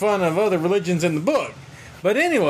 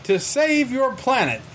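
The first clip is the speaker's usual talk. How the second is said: high, slow proclamation; heroic Superman; 7 semitones higher, slower than usual. Retold or imitated. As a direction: announce it like a cartoon hero.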